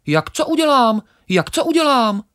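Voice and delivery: very high, loud, quick chirpy sparrow voice; cheeky sparrow. Acted. Sparrow laughs off the grain, very high and cocky.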